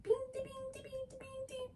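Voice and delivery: tiny, very high, soft squeaky patter; little mice. Retold or imitated. Sneaking everybody out past stepdad, little mice squeaks. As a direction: tiptoe out in hushed high-pitched bings. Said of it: "like little mice".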